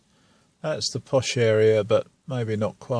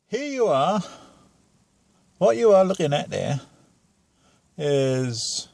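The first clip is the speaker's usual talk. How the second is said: high camp dame voice, lightly hammed; shrill camp pantomime dame; 5 semitones higher than usual. Showing screen in put-on voice.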